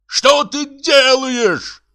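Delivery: high, drawn-out bellow; acted outraged husband; horrified yell. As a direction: yell it slowly at the top of the range. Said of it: "заорал Бутронце".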